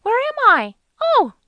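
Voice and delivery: put-on loud, very high panicked girl voice; high-pitched, frightened. A narrator's reading. Frightened girl waking in a strange kitchen, high-pitched cry. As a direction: cry out shrilly high in alarm. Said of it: "Her voice was high-pitched, frightened".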